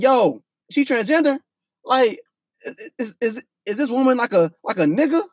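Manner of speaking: high, stammering, with a rising question on each line; retold flustered panic; baffled disbelief. Telling friends what he wondered about his date, baffled disbelief.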